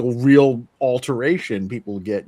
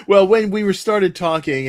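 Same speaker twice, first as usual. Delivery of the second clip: louder, talking through a laugh; 7 semitones higher, 7 dB louder than usual. Real, not acted. Host to guest, recalling her remark, laughing. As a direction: still giggling as you explain.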